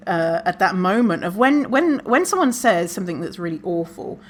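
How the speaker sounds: ordinary talk, nothing marked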